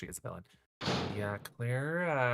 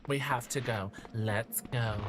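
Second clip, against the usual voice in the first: slow creepy villain voice. Retold or imitated. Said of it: "Why does Claire sound like a villain".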